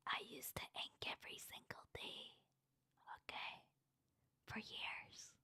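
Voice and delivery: mock-secret whisper to chat; whispered confession. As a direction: whisper it close to the mic like a secret. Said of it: "Let me do this anonymously".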